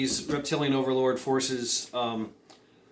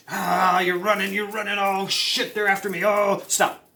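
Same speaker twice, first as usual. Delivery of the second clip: higher, hammed-up panicked cry; mock panic; 5 semitones higher than usual. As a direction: play someone fleeing in a comic panic. Acts out fleeing in panic.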